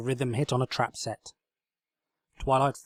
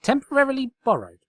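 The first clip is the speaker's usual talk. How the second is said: loud high prim correction; loud indignant correction; correcting; 14 semitones higher, 8 dB louder than usual. Acted. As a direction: correct it primly. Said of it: "Twilight corrected".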